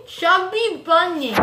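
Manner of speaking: laughing through a stuffed mouth; cheeks stuffed full. Real, not acted. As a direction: giggle out the words past marshmallows. More marshmallows, laughing while saying.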